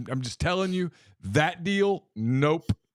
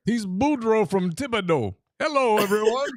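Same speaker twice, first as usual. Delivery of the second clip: mock Cajun accent; 4 semitones higher than usual. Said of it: "he has a Southern accent".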